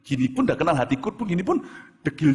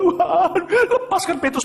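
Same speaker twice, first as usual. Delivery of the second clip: mock pleading prayer; 13 semitones higher, 5 dB louder, slower than usual. The praying disciples voiced.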